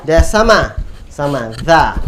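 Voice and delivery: ordinary delivery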